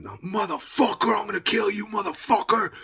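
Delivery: high, strained raging threat; re-enacted rage. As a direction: act out yelling in a rage, without full volume. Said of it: "I'm fucking just raging".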